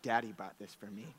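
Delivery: whisper-proud of a gift; proud child murmur. Retold or imitated. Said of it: "she said".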